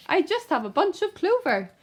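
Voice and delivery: small rabbit pleading with the bandit; timid rabbit. Acted. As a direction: squeak it out, frightened. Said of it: "the rabbit replied".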